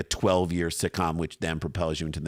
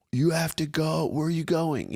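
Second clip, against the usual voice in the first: put-on much higher, plaintive child's voice; imitating the kids; 9 semitones higher than usual. Kids upset as parent packs, high pleading child voice. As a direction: play the child seeing the suitcase. Kids see the suitcase come out.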